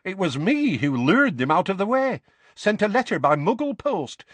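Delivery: high, lively, gleeful boasting; young witch. A narrator's reading. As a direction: brag excitedly in a high young woman's voice. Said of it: "said the violet-haired woman".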